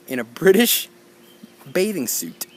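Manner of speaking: usual speaking voice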